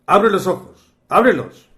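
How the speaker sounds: acted-out commanding call; retold command. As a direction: order him sharply to open his eyes, retelling it. Master orders boy to open eyes.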